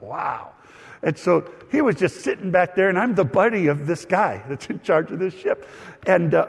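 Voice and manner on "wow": low, very soft aside; hushed awe. Retold or imitated. Young pastor privately marvels at his buddy's authority, hushed. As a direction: drop to an awed murmur, as if thinking.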